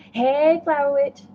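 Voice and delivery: loud, high sing-song call; a bright mock greeting shouted high. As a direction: call her name across the room. Calling out a greeting.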